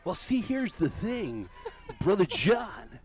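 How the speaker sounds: high, slow, loud preacher voice; hammed-up preacher, high and drawn out; Brother Judah preacher. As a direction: proclaim it like a revival preacher shouting to the pews. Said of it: "Then there's the Abdel Judah".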